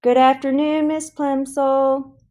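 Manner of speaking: high, slow chanted greeting of a class; sing-song class greeting. A narrator's reading. Pupils chorusing to their teacher, slow and sing-song. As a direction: drag it out like schoolchildren in unison.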